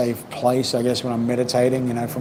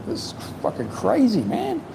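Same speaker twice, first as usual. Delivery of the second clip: amazed exclamation; 9 semitones higher than usual. Retold or imitated. Retold reaction to loud thunder.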